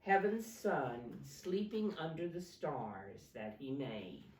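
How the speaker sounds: put-on dramatic read-aloud for kids; storybook reading. A narrator's reading. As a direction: read the Christmas story to little ones with energy. Reading picture book to children.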